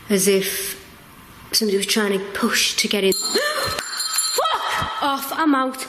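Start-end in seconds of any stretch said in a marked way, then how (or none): 3.1-5.8 high gasping panic then nervous laugh; bolting in fear, laughing it off; panicked gasp. Real, not acted. Terrified vlogger gasps and bails on the vigil. Fleeing after scare.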